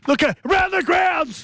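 high, excited announcer shout; calling out. Real, not acted. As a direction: holler the segment title. Calls out the show segment.

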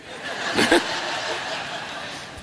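a soft airy laugh; quiet unvoiced chuckle; breathy laugh. Real, not acted. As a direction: laugh under your breath at yourself. Laughing after the golf joke.

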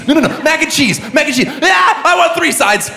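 high-pitched, overexcited indecision; mock frantic excitement. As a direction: blurt it out high, like an overexcited eater. Mock agonizing over side dishes.

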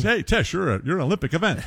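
high, fast, talking through fries; mouth full. Retold or imitated. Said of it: "chewing on French fries goes".

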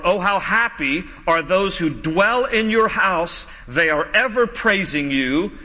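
slow, savoured Oh, how happy are those; slow scripture reading. Shown as a demonstration. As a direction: read the verse slowly and reverently.

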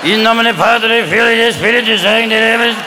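put-on old pope chanting a Latin blessing; droning papal prayer; John Paul the Second. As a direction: intone it on one level note. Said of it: "Remember John Paul the Second? It was like".

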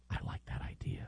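full unvoiced whisper; very soft, hushed approval. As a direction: whisper it like a secret podcast. Demonstrating the whispering podcast.

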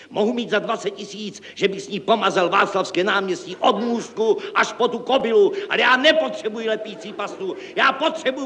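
sustained, very high-pitched tirade; hysterical ranting. A narrator's reading. Director berates staff over glue paste, hysterical rant. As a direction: rant shrilly, at the end of your tether. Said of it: "volal hystericky".

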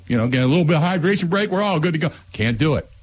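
normal talking voice

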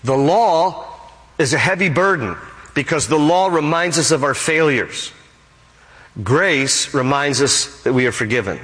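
usual speaking voice

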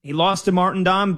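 ordinary delivery

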